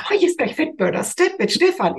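high, gushing fan imitation; all giddy. Women waiting for Stefan's class, giddy high squeal. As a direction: squeal it like a flustered admirer. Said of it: "alle so ganz wuschig".

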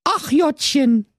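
high slow sighing mum; high slow Berlin sigh; Berlin mother goat. A narrator's reading. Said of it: "dachte die alte Geiß".

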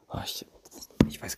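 half-whispered muttering to himself while fumbling with the phone; whispered aside to himself. Real, not acted. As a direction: drop to a breathy aside, as if off-mic.

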